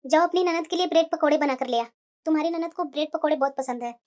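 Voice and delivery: put-on soft older mother. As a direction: send the bahu off to cook. Mother instructs daughter-in-law.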